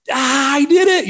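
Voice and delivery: high, loud gleeful burst; imitated triumphant kid. Boy popping up from the water, imitated triumph. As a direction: burst out with it, breaking the surface.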